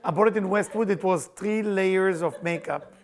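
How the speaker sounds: usual speaking voice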